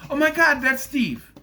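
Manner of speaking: high, loud mock fan gushing; hammed-up awestruck recognition; excited viewer. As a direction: exclaim it like a thrilled trailer watcher. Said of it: "the people at home will go".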